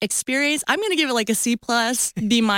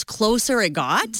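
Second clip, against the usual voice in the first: ordinary delivery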